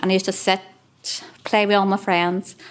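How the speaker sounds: usual speaking voice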